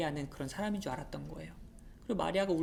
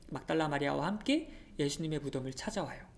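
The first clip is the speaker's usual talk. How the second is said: usual speaking voice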